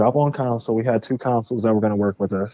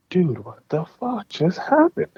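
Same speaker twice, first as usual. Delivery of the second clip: slow, higher-pitched stunned question; retold disbelief; 7 semitones higher than usual. Founder reacting to his partner's betrayal, stunned disbelief.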